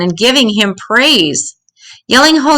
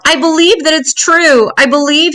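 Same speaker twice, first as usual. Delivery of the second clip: high, eager burst of belief; a donkey bursting out in sudden faith; 7 semitones higher than usual. A narrator's reading. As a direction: blurt it out, overjoyed. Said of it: "The donkey burst out".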